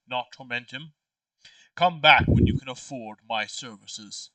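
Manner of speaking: ordinary delivery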